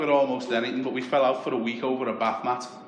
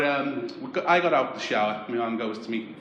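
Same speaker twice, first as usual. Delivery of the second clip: usual speaking voice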